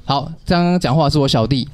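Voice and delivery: ordinary talk, nothing marked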